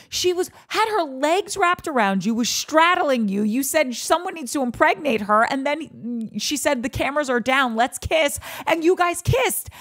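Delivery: high-pitched, hammed-up accusation; indignant confrontation. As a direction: throw the evidence at him, pitched high. Confronting the absent man about lie.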